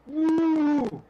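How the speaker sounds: high, loud "woo"; excited whoop. Retold or imitated. Retelling the reaction to the actor's offer, excited whoop. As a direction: let out one long high whoop.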